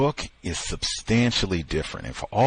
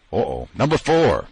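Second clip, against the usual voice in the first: ordinary talk, nothing marked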